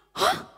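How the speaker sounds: short, high-pitched gasp; sudden acted intake of shock. A narrator's reading. Mother opens the door on Jojo, startled gasp. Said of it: "Mamanya kaget".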